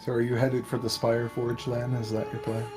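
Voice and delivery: ordinary delivery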